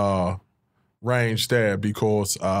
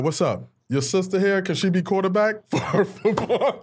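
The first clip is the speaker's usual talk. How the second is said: put-on higher, eager kid voices; friends' voices; 7 semitones higher than usual. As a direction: play the neighbourhood kids begging. Friends voiced asking for sister.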